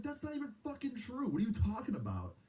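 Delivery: high, indignant retold protest; retold angry outburst. Retelling how he protested the lie, in high outraged voice. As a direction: throw it back at him, outraged.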